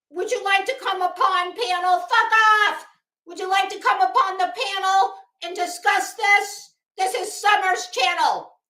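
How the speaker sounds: high, loud mock livestream host rant; P G imitation. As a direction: lurch between panel invites and cursing. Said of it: "that's a P G imitation".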